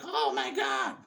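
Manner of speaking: mock hysterical cry. 1933 audience watching the train crash, mock hysterical. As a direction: cry out like a hammed-up horrified moviegoer. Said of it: "did I just do the hysterical one?".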